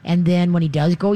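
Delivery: ordinary delivery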